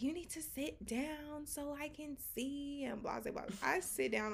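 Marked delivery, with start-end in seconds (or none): 0.0-2.8 slow, higher mimicked voice; imitated instruction. Haircutter telling her to sit down, drawn-out mimicry. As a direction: draw it out slowly, a little softer and higher.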